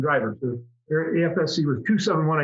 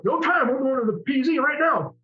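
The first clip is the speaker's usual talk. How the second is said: put-on southern accent; 9 semitones higher than usual. Commander refusing to wait, southern accent. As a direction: cut him off like a Southern commander. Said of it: "he had this southern accent".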